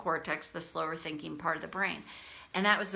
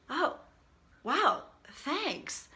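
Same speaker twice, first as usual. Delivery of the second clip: imitating a taken-aback husband, higher pitch; retold stunned thanks; shocked; 8 semitones higher, much slower than usual. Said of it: "he was shocked and he goes".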